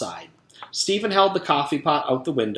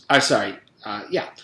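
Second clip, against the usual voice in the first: plain reading voice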